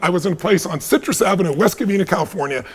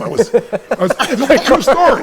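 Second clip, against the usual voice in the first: higher, louder, laughing through the words; 7 semitones higher, 4 dB louder than usual. Real, not acted. Telling the audience he lived in his car, laughing. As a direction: laugh as you confess it.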